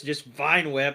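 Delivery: usual speaking voice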